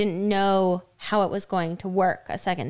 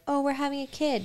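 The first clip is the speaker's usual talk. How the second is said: higher, soft mock-elated exclamation; mock elation; 7 semitones higher, 4 dB quieter than usual. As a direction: act out quiet, pretend delight. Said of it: "be so elated and be like".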